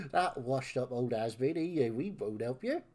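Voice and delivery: taunting West Country villain. Acted. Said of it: "doing a villain voice in West Country".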